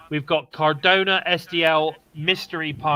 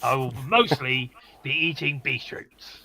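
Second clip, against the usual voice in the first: West Country yokel voice. Retold or imitated. Continuing the Fast Show impression.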